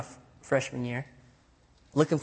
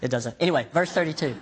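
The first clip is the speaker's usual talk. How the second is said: ordinary talk, nothing marked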